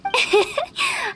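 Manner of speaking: a quick high playful laugh; teasing giggle. Acted. Hu Tao teasing Paimon with a giggle.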